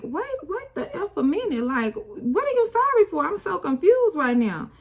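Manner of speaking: high, loud, frantic confusion; high-pitched frantic what-is-happening; shocked disbelief. Retold or imitated. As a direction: replay the panic of not understanding, pitch way up. Retelling her shocked reaction.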